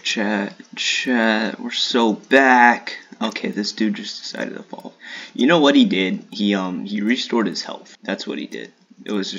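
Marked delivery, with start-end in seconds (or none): 0.0-3.0 building hype. Real, not acted.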